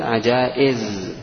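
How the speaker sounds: عجائز drawn out slowly with the hamza; slow teacher model; slow careful model. Shown as a demonstration. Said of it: "كيف الكلمة بعد الإبدال".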